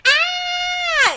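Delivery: high pretend-scared squeal; long, high play scream; mock scream. Storyteller pretends to be scared of the wolf, high mock scream. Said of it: "pretend to be really scared".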